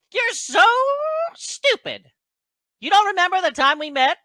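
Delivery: high, loud, exasperated halfling; Dabbledob. Acted. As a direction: squawk the insult in acted outrage. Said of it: "he gets all, like, mad".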